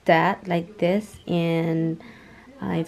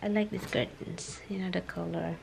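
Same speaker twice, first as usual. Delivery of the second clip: whispering; 12 dB quieter than usual. Real, not acted. Whispering to not wake.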